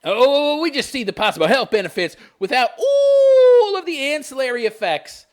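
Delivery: high, loud, gushing mockery; mock naivety. Commentator voicing ban supporters blind to side effects, mock naivety. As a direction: ham up the oblivious do-gooder.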